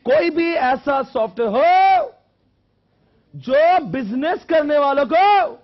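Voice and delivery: slow, high, booming lecture shout; very loud, drawn-out teacher's call; shouted dictation. Shown as a demonstration. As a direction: belt it out word by word, stretching the endings. Repeating definition in Hindi for class.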